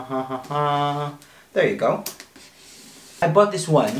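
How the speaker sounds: ordinary delivery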